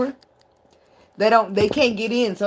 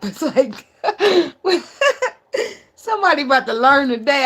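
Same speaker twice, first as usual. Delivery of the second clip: higher, louder, through laughter; laughing; 6 semitones higher, 4 dB louder than usual. Real, not acted. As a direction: laugh while warning them. Laughs at own threat.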